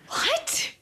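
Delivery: breathless, high whispered what; real shock, almost no voice; shocked gasp. Real, not acted. Reacting to her friend's angry meditation story, gasping what.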